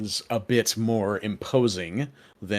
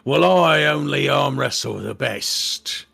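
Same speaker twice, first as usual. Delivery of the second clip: higher-pitched drow, boastful; haughty drow challenger; 6 semitones higher, 4 dB louder than usual. Acted. Drow sizing up newcomers to the competition, haughty. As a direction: boast like a champion arm wrestler.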